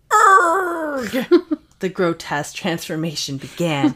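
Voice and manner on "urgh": high loud strained groan breaking into a laugh; agonized groan. Acted. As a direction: cry out in pain as the body twists. Kyle's painful transformation begins.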